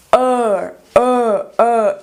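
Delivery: "er" as a long rounded vowel; teacher modelling the long er sound. Shown as a demonstration. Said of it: "O próximo é esse som".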